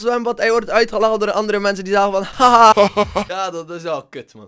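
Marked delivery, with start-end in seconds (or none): 2.2-2.7 loud jeering laugh; mocking laugh. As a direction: laugh at him like a bully.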